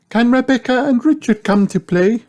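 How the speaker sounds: very high character voice; Missus Rabbit. A narrator's reading. Rabbit mum phoning about a playdate, high-pitched asking. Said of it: "she asks".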